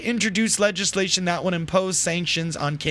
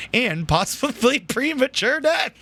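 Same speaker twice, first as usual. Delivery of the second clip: laughing; 5 semitones higher than usual. Real, not acted. Cracks up mid ad parody.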